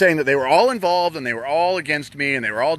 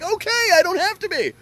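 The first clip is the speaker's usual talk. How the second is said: breezy agreeable self. Retold or imitated. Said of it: "And I would have been like".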